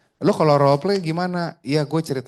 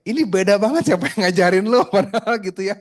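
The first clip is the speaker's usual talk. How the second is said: amused disbelief; 8 semitones higher, 5 dB louder than usual. Real, not acted. Coach mocking trainee's pitch.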